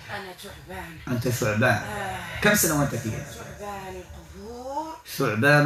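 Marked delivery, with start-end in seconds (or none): 0.1-1.0 distant, hushed possessed voice; thick strong voice. Real, not acted. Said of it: "بصوت غليظ قوي". 3.3-5.0 distant, hushed possessed voice; thick strong voice. Real, not acted. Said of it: "بصوت غليظ قوي".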